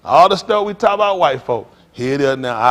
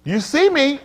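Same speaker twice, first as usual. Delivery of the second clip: ordinary talk, nothing marked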